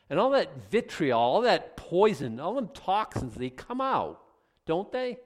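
loud, slow preacher build; preaching at full force. Acted. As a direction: drive the point home from the pulpit.

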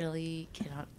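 breathy whispered give-up. Real, not acted. Giving up on the acting game, whispering. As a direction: drop to a whisper, defeated.

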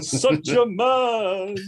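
drawn-out high joke voice, hamming it up; mock macho praise.

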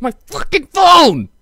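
slow, loud exasperated yell; shouting. Real, not acted. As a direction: bellow it in disbelief. Exasperated rant peak.

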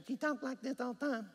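high-pitched North Georgia twang impression; North Georgia accent. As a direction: imitate a North Georgia talker. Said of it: "one of those North Georgia accents".

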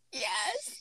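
teary yes. Retold or imitated. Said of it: "Are, are you crying?".

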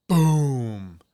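imitated explosion, one long falling boom; loud drawn-out "boom" sinking in pitch; firework boom. Recounting a bomb-like firework, imitated boom.

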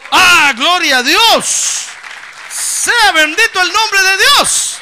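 slow, full-force preaching shout; shouted praise. Acted. Preacher bellowing praise at the congregation, full force. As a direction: cry out glory to God from the pulpit.

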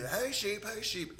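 put-on a young visitor trying the uncle's call, mildly New Zealand; nephew copying the shepherd call. Nephew imitating the uncle calling sheep, in a light New Zealand accent. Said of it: "And he'd do".

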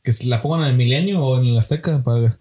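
ordinary talk

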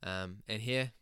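soft, even intro read; podcast intro. Acted. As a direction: read the episode intro calmly. Pre-recorded podcast intro read.

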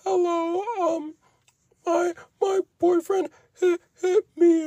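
high, sobbing imitation on the phone to police; fake-cry like she's calling the cops; high weepy mock sob; mock crying girlfriend. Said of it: "she's like".

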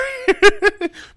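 loud high-pitched laugh. Real, not acted. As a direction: burst out laughing. Laughs at own extreme examples.

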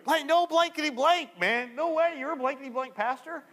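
high-pitched, astonished stranger; incredulous disbelief. Retold or imitated. Barroom stranger learning his companion is a pastor, high incredulous outburst. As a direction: blurt it out in amazed disbelief.

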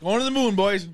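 high excited shout-out; excited cheer. Pretend. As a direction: cheer it off to the moon. Cheering the bike off.

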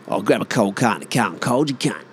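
Aussie drive-through order run together; Aussie larrikin ordering beer; broad Aussie order. Acted. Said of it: "the most Aussie fucking thing to order".

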